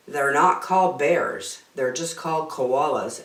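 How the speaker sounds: usual speaking voice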